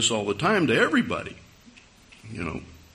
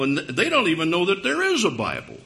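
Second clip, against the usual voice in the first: ordinary delivery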